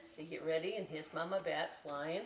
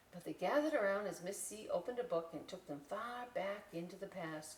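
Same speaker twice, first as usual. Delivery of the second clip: ordinary delivery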